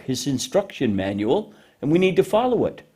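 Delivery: usual speaking voice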